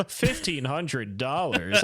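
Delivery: slow, laughing echo of a price; mock incredulity. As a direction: scoff at the sum, drawing out each word.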